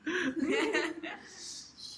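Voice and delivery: long giggle at her own joke; laugh. Real, not acted.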